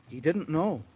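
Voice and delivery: plain reading voice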